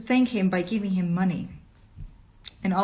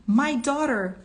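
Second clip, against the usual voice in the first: sobbing; 7 semitones higher, 5 dB louder than usual. Acted. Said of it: "he sobbed".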